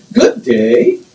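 British Virgin Islands lilt, rising on the last word; sing-song island greeting. Shown as a demonstration. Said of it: "a sing song".

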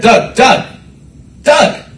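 imitating Doug. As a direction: call the name like the director did. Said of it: "That's how he talks".

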